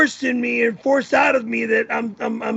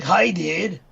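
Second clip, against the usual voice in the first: surfer voice. Shown as a demonstration. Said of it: "what a surfer voice is".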